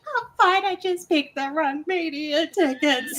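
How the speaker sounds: high, tearful brave-face voice; mock tearful reassurance. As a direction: insist you're fine through tears. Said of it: "I'm like".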